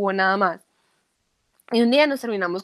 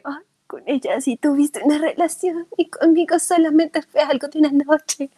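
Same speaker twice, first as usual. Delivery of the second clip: reproche quejumbroso; 6 semitones higher, 4 dB louder than usual. Retold or imitated. Reproche imitado a su amigo.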